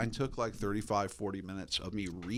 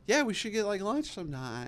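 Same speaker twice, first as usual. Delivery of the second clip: flirty invite; 11 semitones higher than usual. Retold or imitated. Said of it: "she said something that was just like".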